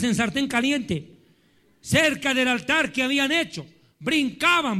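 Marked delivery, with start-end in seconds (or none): none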